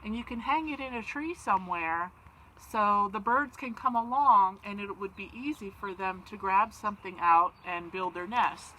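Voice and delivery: plain reading voice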